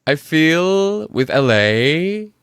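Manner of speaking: slow, drawn-out LA accent rising like a question; mock LA accent, stretching phrase ends upward; LA uptalk. Shown as a demonstration. Podcast host imitating LA talk to guest, exaggerated slow uptalk. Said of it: "you just go up".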